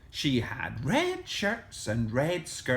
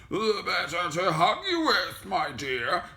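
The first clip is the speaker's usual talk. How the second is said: growly storybook wolf, pitched up; growling wolf; 6 semitones higher than usual. A narrator's reading. Wolf in bed answering Little Red, growling voice. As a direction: growl it with relish. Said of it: "growled the wolf".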